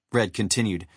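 ordinary delivery, nothing marked

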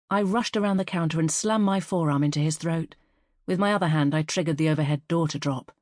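plain reading voice

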